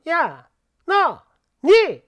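slow, drawn out, then a loud bark on 니; rude mother-in-law. Retold or imitated. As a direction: mimic a mother-in-law barking at her daughter-in-law. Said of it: "이렇게 부르는데요". (느리게 끌다가 크게 내지르는 시어머니 흉내)

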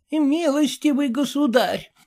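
put-on high, grand old dame address; stately old lady. A narrator's reading. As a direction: address him with lofty dignity. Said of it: "величаво сказала старуха".

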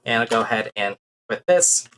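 ordinary talk, nothing marked

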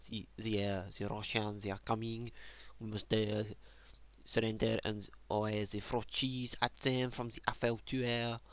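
hammed-up French accent. Frenchman warning that Russians are coming, mock French accent. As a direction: play a comically panicked Frenchman with heavy French vowels. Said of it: "If we are French, we say".

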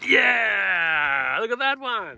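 loud, high whoop of joy; loud joyful shout over the landed fish; triumphant shout. Real, not acted. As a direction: cheer the catch at full voice.

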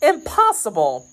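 a touch of panic; panic-stricken exclamation. A narrator's reading. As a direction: exclaim it in shocked disbelief. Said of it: "exclaimed the panic-stricken Grimaud".

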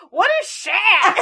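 put-on high, loud kid's question; slow, loud retold child's cry; puzzled child. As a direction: imitate a baffled kid shouting from the back seat. Voicing child in back seat.

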